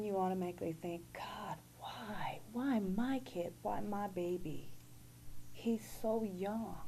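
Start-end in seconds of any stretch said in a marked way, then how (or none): 1.1-2.4 hushed, broken plea to God; whispered plea. Retold or imitated. A mother whispers to God why her child. 2.5-6.8 anguished plea. Retold or imitated. Anguished questions to God.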